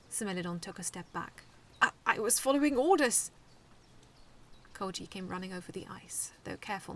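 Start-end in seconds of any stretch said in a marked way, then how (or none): 1.8-3.3 nervous excuse. A narrator's reading. Nervous robot defends itself.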